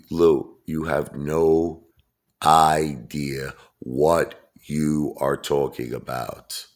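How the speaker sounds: drawn out word by word; slow, emphatic put-down. Real, not acted. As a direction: dress him down very slowly, pausing for weight. Scolding Lou word by word.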